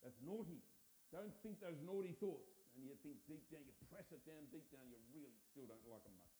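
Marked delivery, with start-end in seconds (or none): 0.1-2.5 louder, scolding moralizer; mock scolding preacher. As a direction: wag the finger, mock-scold loudly. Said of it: "trained like".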